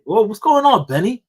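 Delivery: high, loud hype-man welcome; hyped greeting. Pretend. Vlogger welcoming channel member Benny, high hype greeting. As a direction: greet him like a big-voiced host. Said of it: "every time I say".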